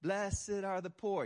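preacher's poetic reading; churchy flair. Shown as a demonstration. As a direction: intone it like scripture with flair. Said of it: "extra flair on it".

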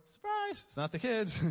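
high, loud, playful shout; spring it on them in a high voice; mock surprise. Springing surprise on man at door.

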